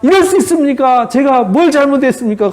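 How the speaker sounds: high-pitched, pleading protest; Job protesting. Acted. Job clinging to God and demanding answers, acted protest. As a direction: plead your innocence in rising indignation. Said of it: "하나님 향해서 막 항변해요".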